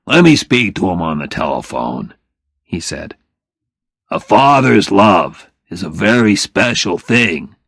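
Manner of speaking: kindly father pleading for family; lightly voiced father. Phil offers to help.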